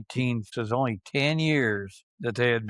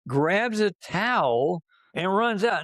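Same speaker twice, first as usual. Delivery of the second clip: ordinary delivery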